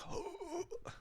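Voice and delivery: high, strained gasp on the toilet; straining gasp. Retold or imitated. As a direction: gasp as if fighting for your life. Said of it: "and you're like".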